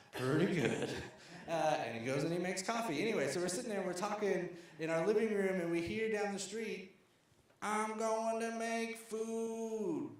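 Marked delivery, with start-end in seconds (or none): 0.1-0.9 imitated neighbor's loud reply; Cool Breeze. 7.6-10.1 high, long-drawn holler; imitated street yell. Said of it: "Sheik is yelling at everyone".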